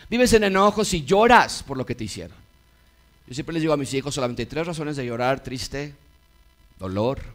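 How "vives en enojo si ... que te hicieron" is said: fiery pulpit delivery; preaching at full force. Acted. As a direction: preach it out to the congregation. Pastor preaching loudly.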